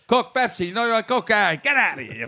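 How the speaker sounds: hint of a Greek diner owner shooing you off; John Belushi diner guy. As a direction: rattle off the order like a pushy counterman. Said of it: "John Belushi on Saturday Night Live".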